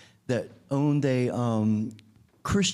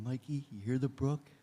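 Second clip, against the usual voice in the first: hushed whisper; 9 dB quieter than usual. Retold or imitated. Tender words to a dying boy.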